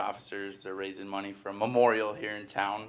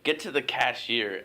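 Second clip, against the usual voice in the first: ordinary talk, nothing marked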